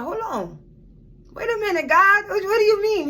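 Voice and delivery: high-pitched, taken-aback objection; startled protest. Retold or imitated. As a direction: protest in disbelief, voice jumping up. Acting her startled reply to God.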